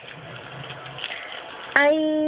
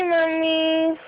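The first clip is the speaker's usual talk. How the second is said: ordinary delivery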